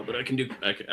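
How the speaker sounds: usual speaking voice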